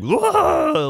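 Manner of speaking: high put-on yelp; voice demo character. Shown as a demonstration. Comic showing his arsenal of voices, high character yelp. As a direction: throw out a high cartoon cry. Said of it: "like that guy".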